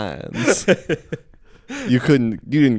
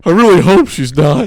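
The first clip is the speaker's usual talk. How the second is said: higher and louder, pleading; high loud mock plea; 10 semitones higher, 9 dB louder than usual. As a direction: blurt it out like a desperate hope. Acted dating thought, loud high.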